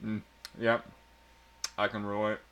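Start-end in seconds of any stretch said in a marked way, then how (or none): none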